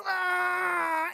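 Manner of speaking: long, high mock scream; imitating falling neighbor. Neighbor falling off the fence, long high mock scream. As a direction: wail as if toppling over.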